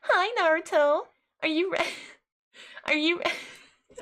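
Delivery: put-on high, sugary anime girl squeak; cute anime girl voice. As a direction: coo it like a shy anime heroine. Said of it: "cute anime girls that are like".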